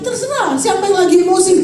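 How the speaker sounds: ordinary talk, nothing marked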